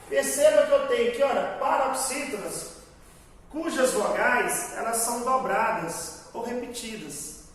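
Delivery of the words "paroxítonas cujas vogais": ordinary talk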